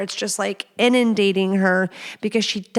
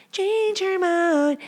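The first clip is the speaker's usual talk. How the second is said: high, slow, singsong emphasis on "Change her mind"; high drawn-out repeat; 11 semitones higher, much slower than usual. Pretend. Host repeating her tip playfully, high and slow.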